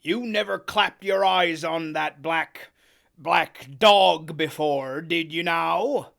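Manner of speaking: loud, slow stern grilling. Acted. Long John grills Morgan, stern and loud. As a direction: drag each word out, menacing. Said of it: "very sternly".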